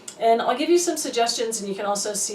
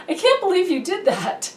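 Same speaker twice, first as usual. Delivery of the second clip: exasperated outburst; 9 semitones higher, 4 dB louder than usual. Shown as a demonstration. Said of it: "if you're, like, sitting there going".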